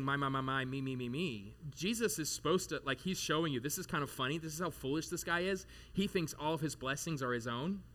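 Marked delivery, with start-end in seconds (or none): 0.0-1.5 mocking arrogant man. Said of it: "He's saying".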